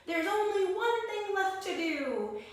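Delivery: excited high hare voice; Hare crying out. Acted. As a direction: cry it out as the anxious hare. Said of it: "Then Hare cried".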